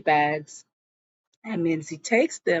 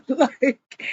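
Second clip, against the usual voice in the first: laughing; 6 semitones higher, 3 dB louder than usual. Real, not acted. Laughing while recapping show.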